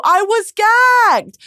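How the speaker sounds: high, loud, drawn-out "gagged"; slow, squealing burst of amazement; astounded exclamation. Real, not acted. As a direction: shout it, stretching "gagged". Exclaims reaction to ending, then repeats.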